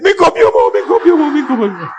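high, hammed-up imitation of frantic pleading; mock wail, starting high and dropping; mock panicked cries. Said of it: "Then he said".

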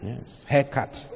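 normal talking voice

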